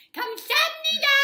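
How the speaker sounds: shouted 감사합니다 with a lisp, very high; loud lisped thank you. Retold or imitated. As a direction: bellow 감사합니다 fast with a lisp. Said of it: "loud and with a lisp".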